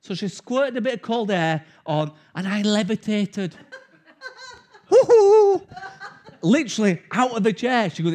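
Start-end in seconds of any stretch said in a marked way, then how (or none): 4.9-5.6 high, loud whooping yelp with a laugh; pain yelp. Retold or imitated. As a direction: yelp as if jolted out of the chair, laughing. Shot of cold air on tooth.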